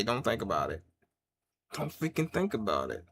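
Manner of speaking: low, hushed warning as an imagined gunman; quiet threat. Acted. Armed man warning a would-be robber off, quiet threat. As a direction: keep it soft and menacing, acted out.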